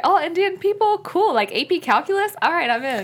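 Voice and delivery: high, bubbly mock enthusiasm; imitating Mindy. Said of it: "she's like".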